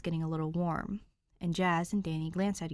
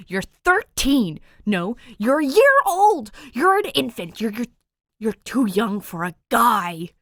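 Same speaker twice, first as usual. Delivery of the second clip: high, loud, stretched-out disbelief; big brother's outraged protest; 6 semitones higher, 14 dB louder than usual. Acted. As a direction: erupt at the sister in outrage. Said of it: "his outrage".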